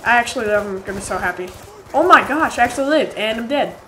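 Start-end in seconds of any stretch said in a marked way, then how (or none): 1.9-3.2 higher and louder, thrilled; excited disbelief. Real, not acted. Player amazed he survived, excited. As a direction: gasp it out thrilled.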